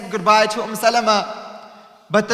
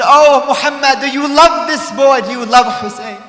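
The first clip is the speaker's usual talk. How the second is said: much louder, higher ringing question; loud solemn question; 4 semitones higher, 9 dB louder than usual. Acted. Jibreel questioning the Prophet, loud and ringing.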